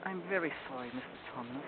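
soft, small apologetic voice; gentle sympathy. Acted. Lucy pities the frightened fawn, gently apologetic. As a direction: say it softly, dropping away at the end.

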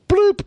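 very high vocal "bloop"; squeaky high scanner noise; imitated scanner beep. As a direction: mimic a scanner's electronic blip.

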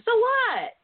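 high sassy so what; defiant. Real, not acted. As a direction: toss it off defiantly. Defiant rhetorical retort.

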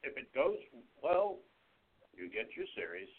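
ordinary talk

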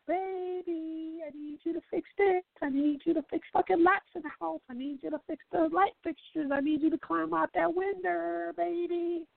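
put-on high sweet-talking girlfriend voice; mock girlfriend. Girlfriend asks her man to fix everything, high sweet plea. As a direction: coax him like a pleading girlfriend.

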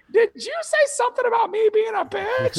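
high fake woman's voice, indignant; prank caller voice. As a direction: squeak it out as the offended caller. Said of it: "I'd be like".

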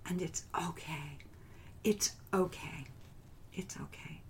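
soft, near-whispered reassurance; self-soothing. Retold or imitated. Woman accepting what is present in her, soothing.

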